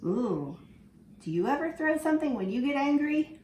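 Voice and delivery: usual speaking voice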